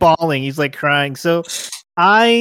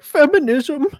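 mock crying Peterson; 11 semitones higher than usual. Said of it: "He's like".

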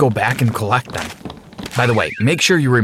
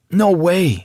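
higher-pitched objection; protesting refusal; 7 semitones higher than usual. Acted. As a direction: refuse outright, pitch raised. Jack refuses to go investigate.

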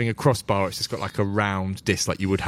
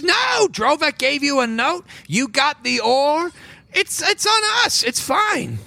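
much higher, sing-song motherly voice; fond shopkeeper woman; slower than usual. Acted. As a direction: coo it to the adventurers like a doting auntie. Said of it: "She says".

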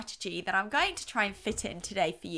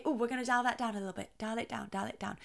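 quick start, then dropping much softer; dialing down; 6 dB quieter, faster than usual. Shown as a demonstration. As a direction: wind the voice down softer with each repeat. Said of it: "Now listen to my voice".

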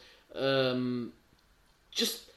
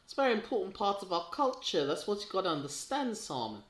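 higher, put-on Kiwi accent; New Zealand accent; 4 semitones higher, 4 dB louder, faster than usual. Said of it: "I don't know if you were doing New Zealand or Chris Eubank there".

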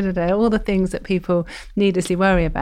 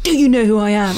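imitating indignant outburst; 3 semitones higher, 6 dB louder than usual. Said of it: "Like, I was so cross".

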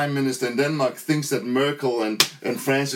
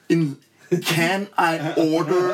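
drawn-out, over-slow mock tourist; slow tourist English; much slower than usual. Mimicking old tourists ordering in Spain, very slowly. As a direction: stretch each word like a tourist abroad. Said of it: "because you say it slowly".

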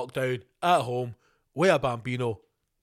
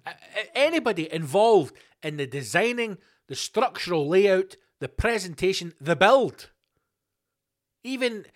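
building rant; 3 semitones higher, 5 dB louder than usual. Acted. Ranting at Braehead's designers, building outrage. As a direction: tear into the mall designers with rising fury.

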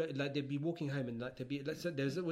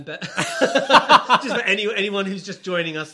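laughing through the words, slow and loud; cracking up mid-sentence; slow loud laughing speech; 7 semitones higher, 18 dB louder, slower than usual. Real, not acted. Laughing over joke.

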